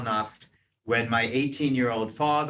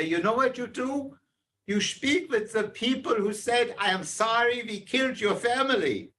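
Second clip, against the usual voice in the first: higher, lightly voiced father; a hint of the father, earnest; father's advice; 9 semitones higher than usual. Father advising son, lightly voiced, higher. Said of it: "He would say".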